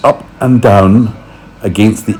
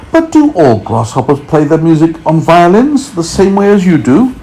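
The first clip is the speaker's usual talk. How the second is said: James asking; 9 semitones higher than usual. A narrator's reading. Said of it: "James asked him".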